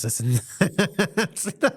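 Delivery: words dissolving into real laughter, high and slow; laughing. Real, not acted. Telling listeners about the friend's cheap wigs, laughing. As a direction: laugh through the end of the list. Laughing while retelling wig story.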